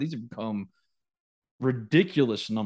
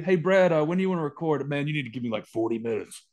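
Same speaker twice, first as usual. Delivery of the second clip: imitating angry Brad. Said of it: "Calling you".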